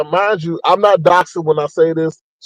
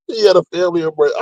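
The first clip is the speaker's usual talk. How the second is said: ordinary delivery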